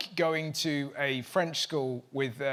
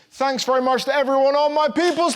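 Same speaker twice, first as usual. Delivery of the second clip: high, loud announcer shout; shouted sign-off; 9 semitones higher, 9 dB louder than usual. Real, not acted. Host thanks everyone at the show's close, shouted sign-off. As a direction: belt it out, holding the last word. Host's big show sign-off.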